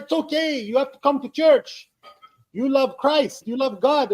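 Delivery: high-pitched imitated Filipino accent. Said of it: "well, in their accent".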